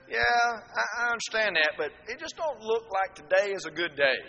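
high, pleading excuse voice; whiny excuse. Acted. Lazy worker begging off in a high whine.

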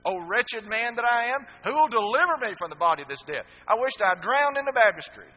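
very loud, high anguished cry; a guilty man shouting his wretchedness; wretched lament shout. Retold or imitated. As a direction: wail it out in despair. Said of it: "that's Romans seven".

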